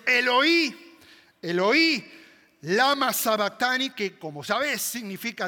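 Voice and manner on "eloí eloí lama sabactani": loud, higher desperate shout; anguished cry. Acted. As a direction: cry out at the top of your voice. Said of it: "está gritando a voz en cuello". (grito desgarrador a voz en cuello)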